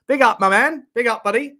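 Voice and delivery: hype shout-out. Real, not acted. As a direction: pump up the shout-out.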